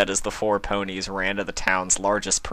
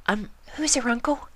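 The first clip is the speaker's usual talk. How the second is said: a worried shy pony; much higher shy little voice; timid pony. Acted. Shy Fluttershy asks.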